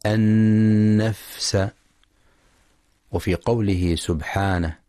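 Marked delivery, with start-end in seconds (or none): 0.1-1.7 النفس with a breathy, voiceless fa, very slow; whispered fa. Shown as a demonstration. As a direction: let the air escape on the fa of النفس. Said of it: "لهمس الفاء الساكنة".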